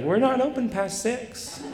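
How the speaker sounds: polite but distant, like closing time; cheery business voice. Retold or imitated. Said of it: "to her business voice".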